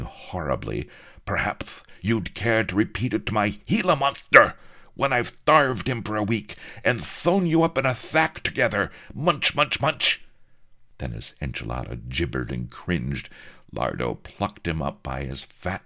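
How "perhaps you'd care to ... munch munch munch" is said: high, loud gloating threat; menacing viceroy. A narrator's reading. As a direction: savor the threat.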